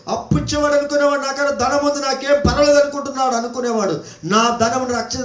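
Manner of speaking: sustained, ringing prophetic proclamation; preaching. Real, not acted. Preacher warning earth's inhabitants from scripture, full preaching voice. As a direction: proclaim it like a warning, stretching the endings.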